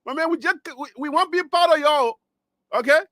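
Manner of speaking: light hammed-up Liberian English pleading; Liberian accent. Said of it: "Let me put in Liberian".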